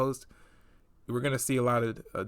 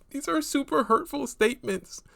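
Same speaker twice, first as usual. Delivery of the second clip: put-on higher, prissy voice of the offended girl; higher mock-hurt girl voice; mocking imitation; 8 semitones higher than usual. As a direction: read her texts like she's clutching pearls. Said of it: "now you're talking about".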